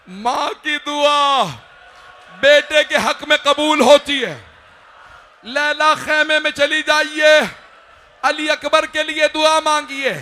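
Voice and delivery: high, slow cries building to a loud, long-held supplication; impassioned majlis preaching. Acted. Hussain asks Laila to pray for their son, impassioned preaching. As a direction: cry the prayer out to the whole gathering.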